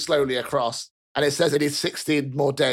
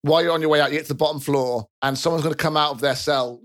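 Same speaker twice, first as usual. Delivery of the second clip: ordinary talk, nothing marked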